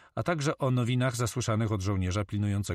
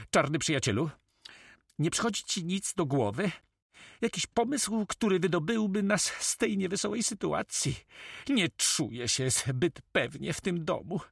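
high-pitched, wheedling character voice; high, worried storybook sailor; uneasy pirate comrade; 10 semitones higher than usual. Acted. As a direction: ask anxiously, pitched well up.

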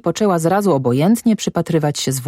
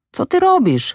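sharply raised pitch, mild shout; shouted challenge; 14 semitones higher than usual. A narrator's reading. Guard stops Zośka climbing on the sledge, crying out. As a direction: cry out in alarm, pitch leaping up. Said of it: "krzyknął dozorca".

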